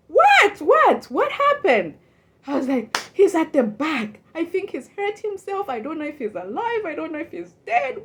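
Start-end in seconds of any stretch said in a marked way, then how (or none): 0.1-2.0 much higher, louder startled questions; shocked, loud, high-pitched what; alarmed What. Retold or imitated. Alarmed at coworker's crying. 3.1-8.0 higher, hurried distressed voice; a hint of a panicked coworker; frantic report. Coworker reports injured man.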